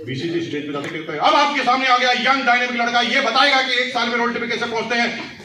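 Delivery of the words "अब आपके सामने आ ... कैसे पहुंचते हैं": loud, high stage introduction; stage announcer. Retold or imitated. Host calling a young achiever up before the crowd, loud announcer voice. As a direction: announce the newcomer to a packed hall.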